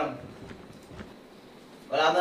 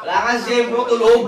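loud taunt; 5 semitones higher, 8 dB louder than usual. Real, not acted. Shouting at downed teammate.

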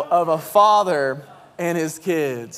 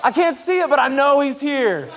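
high, loud, building to a jubilant cry; excited child's shouting; 10 semitones higher, 6 dB louder, slower than usual. Acted. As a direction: shout like a kid, rising into full praise. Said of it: "the sound of them excited I'm home".

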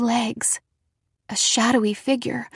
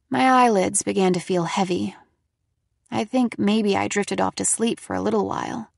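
ordinary delivery, nothing marked

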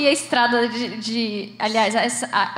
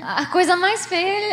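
higher and slow, playfully scandalized; hammed-up dismay; mock disgust; 7 semitones higher, slower than usual. Real, not acted. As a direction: pretend to be appalled, laughing inside. Mock complaint about accent shift.

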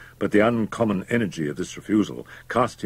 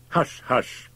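higher, anxious shushing; exclaimed hushing; 7 semitones higher than usual. A narrator's reading. Doctor silencing Fettes, anxious hushing. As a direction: shush him quickly without raising volume. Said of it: "exclaimed the doctor".

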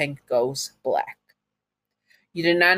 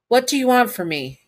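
frightened question; 8 semitones higher, 3 dB louder than usual. Acted. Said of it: "you ask".

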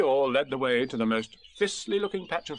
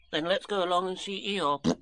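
higher little creature voice; Piglet; 7 semitones higher than usual. A narrator's reading. Small friend suggesting a visit, in a higher voice. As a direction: pitch it up as a small animal. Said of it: "said Piglet".

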